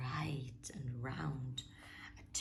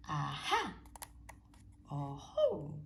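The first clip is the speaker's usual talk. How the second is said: sly creature. Acted. Snake discovers trail.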